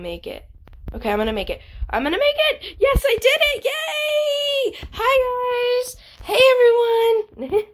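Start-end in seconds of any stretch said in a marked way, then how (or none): 1.9-7.6 very high-pitched, jubilant cheering; triumphant squeal. Real, not acted. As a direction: squeal with victory and greet everyone. Making it across the tightrope.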